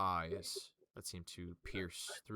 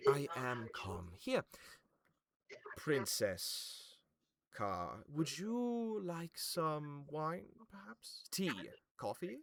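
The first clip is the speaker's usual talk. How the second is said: gracious host; 8 semitones higher, slower than usual. Acted. Venthan offers the guest drinks.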